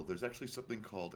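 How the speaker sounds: usual speaking voice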